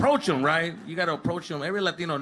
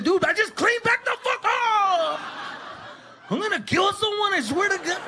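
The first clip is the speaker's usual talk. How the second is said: mock tantrum. Kid taking it out on his brother, high mock tantrum. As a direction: blow up in hammed-up rage. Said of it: "You even take it out on your".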